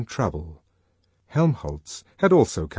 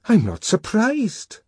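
high, slow, nervous reply; Bernard voice; 13 semitones higher, 4 dB louder, slower than usual. Acted. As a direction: pitch it up, fretful. Bernard voiced, much higher.

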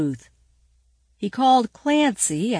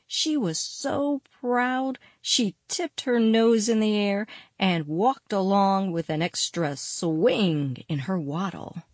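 plain reading voice

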